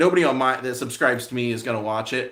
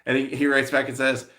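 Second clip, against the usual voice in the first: normal talking voice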